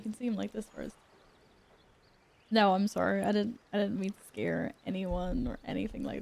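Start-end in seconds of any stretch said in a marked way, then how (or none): none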